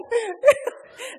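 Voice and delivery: laugh. Real, not acted. Laughs at her own story.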